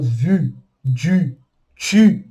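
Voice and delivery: "vu, du, tu" with tight rounded U; pouted French U. Shown as a demonstration. Teacher listing words with the pouted U, pronunciation demo. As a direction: pout each word: vu, du, tu. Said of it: "e o biquinho U".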